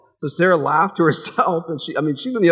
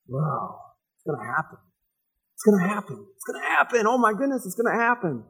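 growing excitement; 5 semitones higher than usual. Retold or imitated. Sarah realizing the child is coming, rising excitement. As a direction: build from awe to glee.

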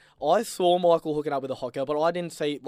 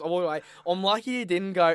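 ordinary talk

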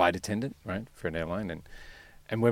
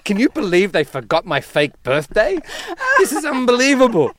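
higher and louder, hammed-up outrage; mock livid outrage; 12 semitones higher, 5 dB louder than usual. Wife complains her fake birthday was forgotten, mock outrage. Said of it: "she was livid".

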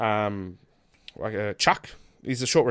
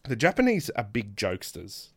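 usual speaking voice

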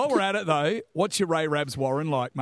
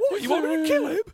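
high, mocking tough guy voice; teasing a mate's weak action hero voice; Statham impression mocked; 9 semitones higher, faster than usual. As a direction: imitate a limp attempt at a hard man. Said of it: "your impersonation of Statham".

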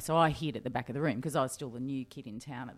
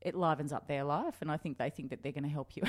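normal talking voice

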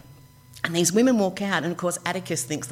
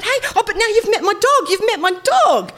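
laughing plea; 7 dB louder than usual. Retold or imitated. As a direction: laugh while pushing her luck. Laughing, pleading with agent.

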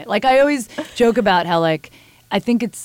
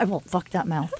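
quiet blurt; 2 semitones lower, 7 dB quieter than usual. Retold or imitated. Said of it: "he just looked at me like".

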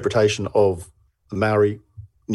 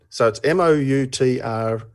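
ordinary talk

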